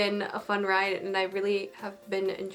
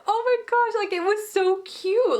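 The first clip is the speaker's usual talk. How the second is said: very high excited squeal; gushing squeal; 11 semitones higher, 5 dB louder than usual. Retold or imitated. Viewer gushing over girl in awe, very high. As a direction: swoon over his back. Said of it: "she's just like".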